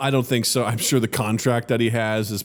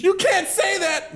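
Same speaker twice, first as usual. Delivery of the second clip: high, drawn-out mock shout; mock outrage; slower than usual. Rival negotiator scolding Denise, mock outraged. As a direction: protest slowly in a high, scandalized voice.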